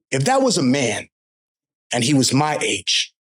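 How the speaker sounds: ordinary talk, nothing marked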